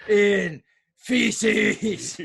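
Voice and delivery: very slow, ominous Undertaker drawl. Retold or imitated. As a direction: drag each word out like a doomed threat. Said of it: "Undertaker impression".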